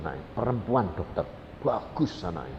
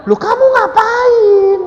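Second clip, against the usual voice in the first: very high, drawn-out loud question; shocked shout; 14 dB louder, slower than usual. Retold or imitated. Husband finding his wife crawling about, shocked shout. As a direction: cry out in high, stretched disbelief.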